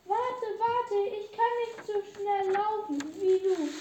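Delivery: the frog calls after the fleeing princess, loud and pleading; calling after. Acted. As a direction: shout after her as she runs.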